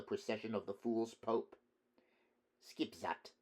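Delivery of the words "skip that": stoic. A narrator's reading. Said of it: "he said stoically".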